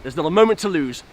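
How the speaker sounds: loud, eager rallying call; rousing call. Acted. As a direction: call the friends onward. Rallying friends to follow.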